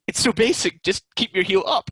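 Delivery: high, loud, fed-up plea to keep the heel up; exasperated instruction. Retold or imitated. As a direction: spell it out in disbelief at how simple it is. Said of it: "And I was like".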